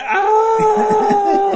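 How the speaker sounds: long high, loud re-enacted scream. Player retelling a kill, one long scream. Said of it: "I'm just like".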